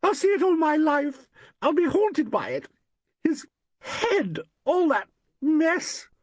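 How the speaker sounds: very high, slow, hysterical wail; a horrified woman losing control; high, shaking hysterical cry. A narrator's reading. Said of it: "voice rose hysterically".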